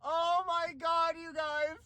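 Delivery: high, hushed tearful cry; real tears of joy; happy crying. Real, not acted. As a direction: choke it out through happy tears. Crying with joy over job.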